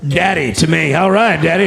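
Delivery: normal talking voice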